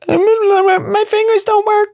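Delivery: very high, slow, whiny complaint; mock whine. Comic self complaining about garlic, high slow whine. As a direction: whimper like helpless fingers.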